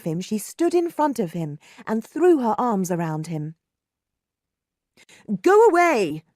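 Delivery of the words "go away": high, loud brushing-off; curt. A narrator's reading. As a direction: push her away with the words. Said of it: "he said".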